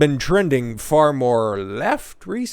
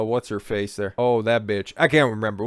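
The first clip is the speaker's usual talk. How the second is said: ordinary talk, nothing marked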